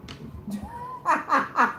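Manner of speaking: very high, light delighted cackle. Real, not acted. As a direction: burst into a high-pitched giggle. Laughs at the people in the reading.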